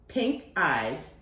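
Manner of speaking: usual speaking voice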